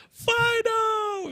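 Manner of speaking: high call across a parking lot. Retold or imitated. Man in the ad calling his dog across the lot. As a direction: cry out to the running dog. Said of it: "he's like".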